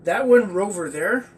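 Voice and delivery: loud eager dog question; excited dog. Acted. Oscar the dog asks the girl, loud and eager. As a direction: blurt it out like an eager puppy.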